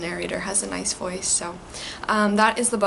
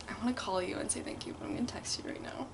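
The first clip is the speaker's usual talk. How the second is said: whispering; 12 dB quieter, faster than usual. Real, not acted. Whispered aside.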